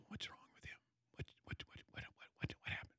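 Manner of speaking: hushed, unvoiced murmur of onlookers; imitated crowd whispering. Crowd of seven hundred wondering about the silence, whispered.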